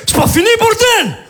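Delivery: frantic deranged shout; crazed neighbor rant. Acted. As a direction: play a sleepless madman banging on the door. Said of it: "je fais".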